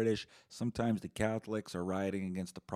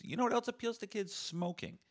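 high rhetorical jab; 13 semitones higher than usual. Real, not acted.

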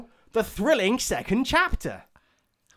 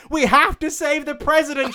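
higher, louder urgent shout; 8 semitones higher, 4 dB louder than usual. Acted. Maiden pummeling the suspect, urgent shout. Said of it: "she shouted".

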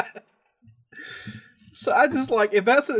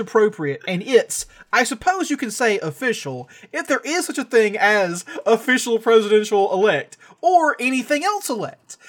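reading a quote; 5 semitones higher, 5 dB louder than usual. Retold or imitated. Reading a senator's quote aloud.

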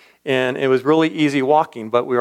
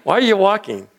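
higher-pitched imitation of the old cowboy; puzzled cowboy, pitched up; 7 semitones higher than usual. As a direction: mimic the baffled old rancher, voice raised.

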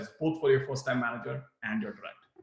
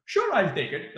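willing, accepting; 5 semitones higher, 4 dB louder than usual. Shown as a demonstration. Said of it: "That's one way to say it".

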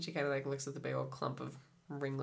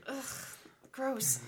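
annoyed ugh; 8 semitones higher, 3 dB quieter than usual. Real, not acted. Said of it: "No, stop".

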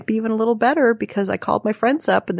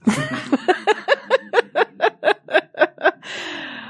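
big laugh; 14 semitones higher than usual. Real, not acted. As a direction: laugh out hard at your own joke. Laughing at own joke.

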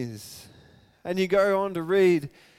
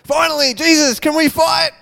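loud, high eager cry; itching for a fight; eager disciples; 10 semitones higher, 11 dB louder than usual. Retold or imitated. Disciple calling to Jesus, loud and eager. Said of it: "It's like".

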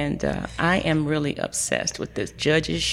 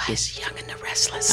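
whispering; 5 semitones lower, 5 dB quieter than usual. Real, not acted. Revealing friend's guilty pleasure.

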